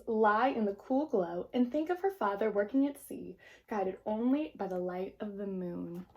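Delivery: put-on gentle read-aloud to children; storybook narration. A narrator's reading. Teacher reading a picture book to the class, gentle narration.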